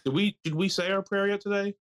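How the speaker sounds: high-pitched mock prayer call; high, put-on congregation leader; mock-solemn lead-in. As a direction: pitch it way up, leading a joke prayer. Leads a mock pregame prayer.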